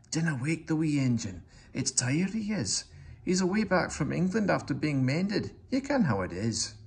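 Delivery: a hint of a Scots brogue, hushed and kindly; Scottish accent. Donald explains the sleeping engine, light Scottish accent.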